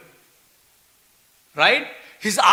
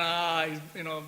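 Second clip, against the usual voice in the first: ordinary delivery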